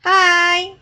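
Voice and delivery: sudden loud hello; shouted friendly hi; loud greeting. Real, not acted. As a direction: hail the stranger loudly. Greeting a stranger in game.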